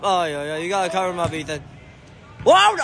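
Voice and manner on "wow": very high, loud exclamation; amazed shout. Real, not acted. Gamer amazed by a great play, loud shout. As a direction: burst out in amazement.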